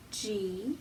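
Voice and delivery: ordinary talk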